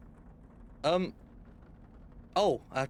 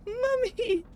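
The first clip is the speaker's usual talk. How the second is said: very high mimicked kid's squeal; high-pitched weird voice; 4 dB louder than usual. Streamer re-enacting a thirteen-year-old calling his mum, absurdly high-pitched. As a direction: mimic a kid's ridiculous call. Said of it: "in the most high-pitched, weird-ass fucking voice".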